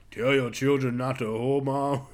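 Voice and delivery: lightly voiced rock singer as a dinosaur; a hint of a brooding metal singer; [name]. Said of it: "It's actually [name] as a mon-, as a dinosaur".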